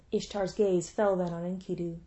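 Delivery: ordinary delivery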